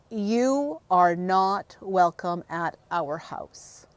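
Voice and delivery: drawn-out mock-stern pronouncement; stern retold rebuke. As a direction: mimic a neighbour laying down the law, word by word. Retelling an angry neighbour's confrontation.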